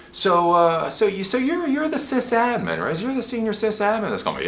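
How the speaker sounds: high-pitched innocent questioning; help desk newbie. Acted. New guy checking who the sysadmin is, high and naive. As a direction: pitch it well up, playing naive. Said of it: "I'm the new guy on the help desk".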